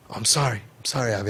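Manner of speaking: soft, low retold apology; mumble-soft, sheepish mock apology; subdued apology. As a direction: imitate a kid quietly saying sorry. Retold apology from a troubled teen.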